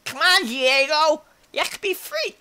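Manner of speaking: high, slow playful pet voice; special pet voice. Pretend. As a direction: talk to him in a silly high voice. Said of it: "And I shall talk to you like this".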